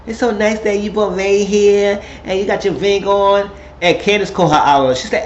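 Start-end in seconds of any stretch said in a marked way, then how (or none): 0.1-3.5 high, mock-gushing compliment; fake-sweet dig. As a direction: imitate shady praise in a raised pitch. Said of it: "Here go Ashley with this dig".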